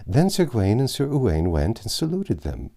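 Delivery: plain reading voice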